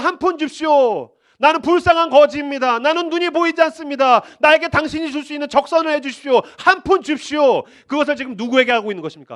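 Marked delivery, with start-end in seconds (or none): 0.0-7.7 loud begging singsong; beggar's plea. Acted. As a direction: whine for alms like a street beggar. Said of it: "세상을 향하여서 하던".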